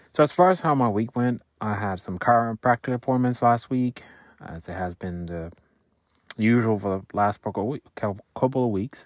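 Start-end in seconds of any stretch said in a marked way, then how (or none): none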